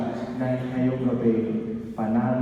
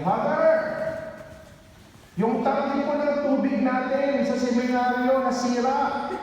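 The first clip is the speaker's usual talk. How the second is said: put-on much higher, anxious young caller; seminarian's voice; 10 semitones higher than usual. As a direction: voice the worried seminarian on the phone. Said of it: "Sabi sa akin".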